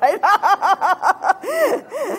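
high, rippling laugh. Real, not acted. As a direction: burst into a high run of laughter. Joking about husbands being right.

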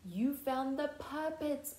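plain reading voice

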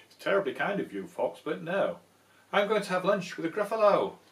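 fast, loud, chirpy little mouse; quick plucky mouse; brisk mouse. Acted. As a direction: cheekily turn him down. Mouse refuses the fox.

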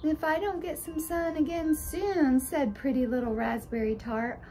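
put-on pretty little girl. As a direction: fuss about your pink cheeks.

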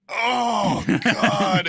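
high, loud groan of dismay, imitated; mock dismayed cry. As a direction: imitate a driver wailing as disaster hits. Driver realises he is pulled over.

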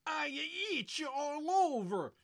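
high, loud wail. Acted. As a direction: wail in itchy distress. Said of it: "he wailed".

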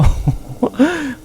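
high, soft burst of laughter; amused, high-pitched chuckle. Real, not acted. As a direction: let out a high, light laugh. Amused at the monster reveal.

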